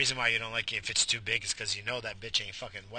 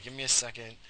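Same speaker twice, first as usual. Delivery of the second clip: ordinary delivery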